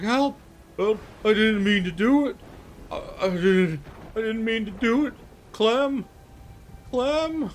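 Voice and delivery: put-on high, tearful pleading of a panicked boy; big frightened lad begging in a high whimper; sobbing, babbling. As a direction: babble desperately through acted sobs. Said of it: "sobbing and just, like, babbling".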